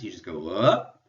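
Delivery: "up" swept sharply upward at the end; rising inflection. Shown as a demonstration. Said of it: "go up with your voice".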